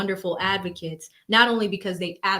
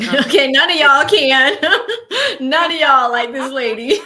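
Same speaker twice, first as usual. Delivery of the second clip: laughing; 7 semitones higher, 10 dB louder than usual. Real, not acted. Amused reaction to co-host.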